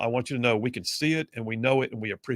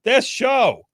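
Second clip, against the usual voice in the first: 1 excited host; 7 semitones higher, 6 dB louder than usual. Acted.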